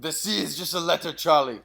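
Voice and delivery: put-on weak German accent on the quote; light put-on German accent; a hint of German villain. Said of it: "you gotta work on that accent".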